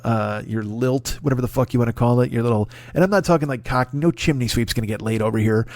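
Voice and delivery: ordinary talk, nothing marked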